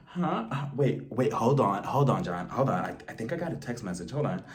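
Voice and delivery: hushed distracted. Lisa shushing John while reading a text, hushed and low. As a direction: drop to a hush, distracted by a text. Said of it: "she's just like".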